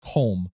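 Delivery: "Colm" run together as one syllable; one-syllable pronunciation. Shown as a demonstration. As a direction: say "Colm" as KOHLM. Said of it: "and not just Colm".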